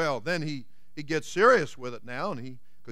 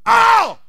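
sudden high loud yelp of pain; 11 dB louder than usual. Acted. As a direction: yelp as the snake bites. Cowboy bitten by snake.

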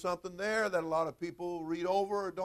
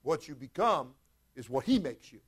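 normal talking voice

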